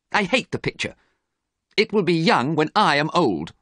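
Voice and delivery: high-pitched bitter complaint; acted angry outburst. A narrator's reading. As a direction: protest with pitch pushed well up. Dorian's outburst continues.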